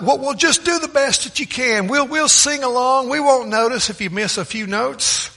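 high-pitched mock call across a room; yelling out from back. Congregation member in the back urges the wife on, calling out. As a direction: imitate someone calling out, pitched well up. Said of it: "somebody in the back yelled out".

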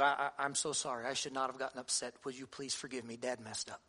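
soft, low re-enacted apology to a child; hushed, humble dad apology; gentle apology. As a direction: apologize tenderly, voice dropped low and quiet. Father apologizing to son in story.

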